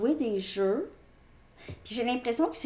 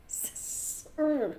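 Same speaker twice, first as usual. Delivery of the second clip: voiced reply; 7 semitones higher, 3 dB louder, much slower than usual.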